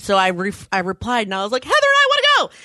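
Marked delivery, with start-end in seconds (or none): 1.6-2.5 high, loud eager squeal. Retold or imitated. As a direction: beg excitedly to go. Retold eager reply.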